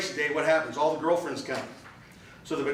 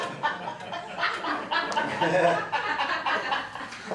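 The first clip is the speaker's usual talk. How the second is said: hearty laugh; 11 semitones higher than usual. Real, not acted. Speaker cracks up at memory.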